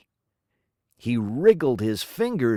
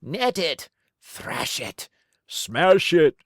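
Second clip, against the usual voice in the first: mob roar. A narrator's reading. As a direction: roar with the angry horde. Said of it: "they roared".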